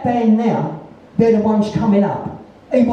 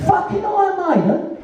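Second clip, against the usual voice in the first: higher disbelieving repeat with a laugh and rising end; incredulous, laughing; 11 semitones higher than usual. Real, not acted. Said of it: "Well, fucking Iron Maiden?".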